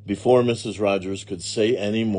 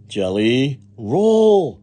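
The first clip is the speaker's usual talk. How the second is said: drawn-out, louder, higher cry of surprise; astonished exclamation; 5 semitones higher, 9 dB louder, much slower than usual. A narrator's reading. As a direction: exclaim it slowly in amazement. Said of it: "exclaimed Amelia Bedelia".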